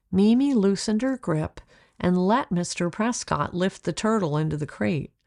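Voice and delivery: ordinary delivery, nothing marked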